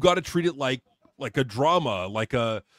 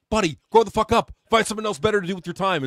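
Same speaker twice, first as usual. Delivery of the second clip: louder, higher scolding, building on grow the fuck up; exasperated telling-off; 6 semitones higher, 5 dB louder, faster than usual. Real, not acted. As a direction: snap at the critic to grow up. Scolding an online critic.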